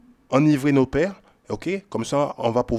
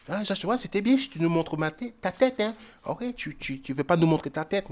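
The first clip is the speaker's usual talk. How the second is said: complaining viewer; 3 semitones higher, 7 dB quieter than usual. Retold or imitated. Viewer asks Joshua to show his head, mild mock complaint. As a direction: mimic a nagging complaint, lightly.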